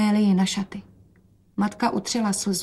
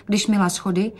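usual speaking voice

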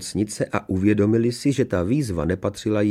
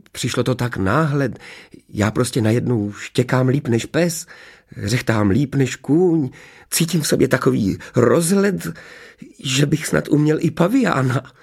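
higher, swelling with amazement at himself; growing enthusiasm; 5 semitones higher than usual. A narrator's reading. Teacher tells inspector of his sudden animal-noise talent, excited. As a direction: get carried away, pitch climbing phrase by phrase.

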